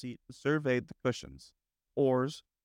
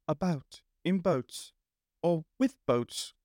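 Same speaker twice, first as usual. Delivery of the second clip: slow, unruffled Rat voice; resume the musing unperturbed, drawn out; composed; 4 semitones higher, slower than usual. A narrator's reading. Rat resumes after tumbling over, slow and composed. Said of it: "the rat went on composedly".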